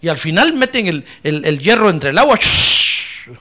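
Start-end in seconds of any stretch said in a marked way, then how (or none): 2.3-3.3 1 hot iron hiss. Retold or imitated. Hissing sound of hot iron.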